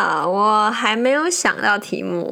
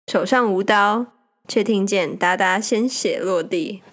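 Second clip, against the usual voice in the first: poem reading. Acted. Reads her own poem.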